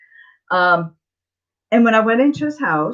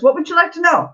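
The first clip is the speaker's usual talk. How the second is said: bubbly psychic; 9 semitones higher, faster than usual. Retold or imitated. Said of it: "And he was really bubbly".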